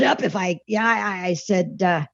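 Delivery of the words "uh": ordinary talk, nothing marked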